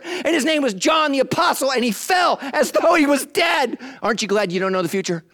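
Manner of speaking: high, loud preaching; full-throated sermon climax; preaching at full force. Acted. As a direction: preach it out with fire.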